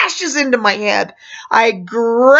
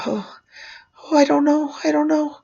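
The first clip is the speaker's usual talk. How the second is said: put-on nervous child; 6 semitones higher, 4 dB quieter than usual. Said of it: "he started getting nervous".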